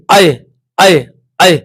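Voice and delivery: आए said slowly and clearly, three times; vowel demo. Shown as a demonstration. Teacher sounds out आए after saying अ plus ई. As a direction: sound out आए for the class. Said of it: "sound निकालिए".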